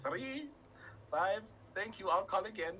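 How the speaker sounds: hammed-up high phone goodbye; mortified caller. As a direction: squeak out the numbers and bail. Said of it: "I hated myself so bad".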